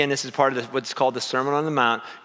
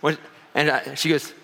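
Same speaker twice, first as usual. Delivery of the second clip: ordinary talk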